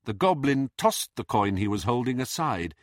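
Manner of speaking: ordinary delivery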